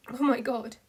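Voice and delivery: a loud, sudden cry of alarm; excited outburst. A narrator's reading. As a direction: burst out at what she sees on screen. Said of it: "Pidge erupted cried".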